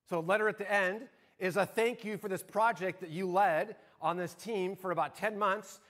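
ordinary talk, nothing marked

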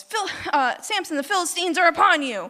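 high, quick alarm call; mock yell. Delilah waking Samson after the haircut, mock yell. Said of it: "and she yells".

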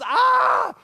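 a sudden, very high, loud yelp; sharp high scream, hammed-up for the story; retold scream of pain. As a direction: yelp like hot rice just hit your back. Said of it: "and he goes".

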